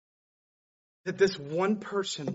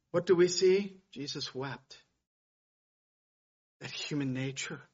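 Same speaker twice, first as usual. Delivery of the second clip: ordinary delivery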